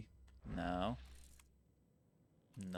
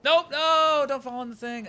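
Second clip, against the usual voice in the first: panicked yelp; 14 dB louder than usual. Real, not acted. Panicked yelp while falling.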